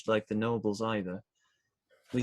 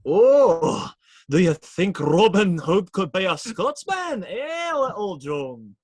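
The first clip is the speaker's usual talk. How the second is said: playful put-on Scottish accent, louder and higher; 7 semitones higher, 7 dB louder than usual. Robin teasing friends, trying on a Scottish accent. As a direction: roll it out as a jokey Scotsman. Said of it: "Maybe you're really Scottish".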